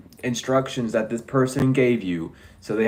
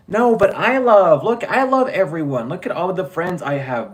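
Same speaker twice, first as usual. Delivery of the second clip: much higher, loud put-on whine; mocking whiny imitation; 7 semitones higher, 4 dB louder than usual. Mocking the jealous rival's voice, high and whiny. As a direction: mimic a fake sweet braggart.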